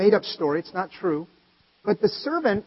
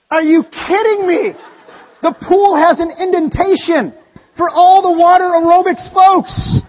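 high, loud, slow disbelief through laughter; incredulous laughing rant, slowed and higher; 7 semitones higher, 9 dB louder than usual. Retold or imitated. Retold exasperation at pool.

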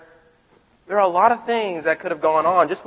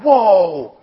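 louder, drawn-out whoa of wonder; awed whoa; 7 dB louder than usual. Retold or imitated. Kids seeing Jesus with wide eyes, awed whoa. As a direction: let the whoa stretch in amazement. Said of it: "their eyes are wide open".

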